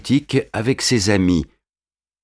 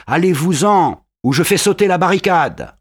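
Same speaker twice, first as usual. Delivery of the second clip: hurling threat; 8 semitones higher than usual. A narrator's reading. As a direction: scream the threat at the soldiers. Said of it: "se met à hurler". (Marius hurle aux soldats)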